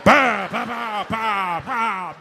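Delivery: loud explosive "bah" booms; loud mock explosions; bomb blasts. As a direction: boom it like artillery. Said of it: "sounded like the bombs at Normandy going".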